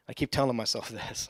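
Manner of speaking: ordinary delivery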